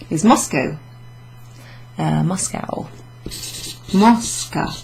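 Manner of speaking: ordinary delivery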